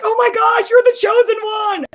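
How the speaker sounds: high, loud excited shout. Retold or imitated. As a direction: shriek it like your friend just won. Friend shouting excitedly after meeting.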